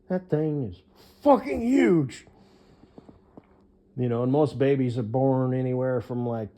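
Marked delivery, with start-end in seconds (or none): 0.1-2.3 slow amazed exclamation. Real, not acted.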